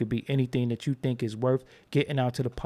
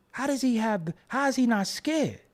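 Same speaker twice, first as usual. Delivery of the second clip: higher-pitched baffled wonder; retold amazement, pitched up; amazed questioning; 10 semitones higher than usual. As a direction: ask it in astonished disbelief. Retells his amazed reaction to Pryor.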